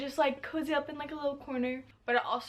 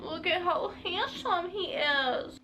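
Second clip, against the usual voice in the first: slow, higher doting coo; slow sweet coo at the pet; cooing at the dog; 6 semitones higher, slower than usual. Real, not acted. Cooing over dog's haircut.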